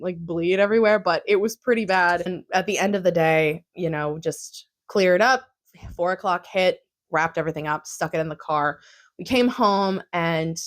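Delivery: normal talking voice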